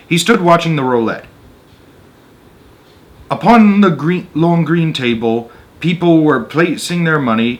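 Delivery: measured read-aloud narrator voice; story narration. A narrator's reading. Reading a Roald Dahl story to an audience, storyteller voice. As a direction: tell the tale steadily to listeners.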